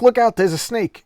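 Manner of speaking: loud, high mock yell; yelling warning. Hunter yelling a warning on safari, loud mock shout. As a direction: shout the warning like a bossy bully. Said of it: "he's going out and yelling".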